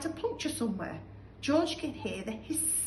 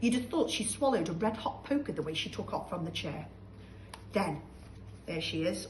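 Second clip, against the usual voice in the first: ordinary delivery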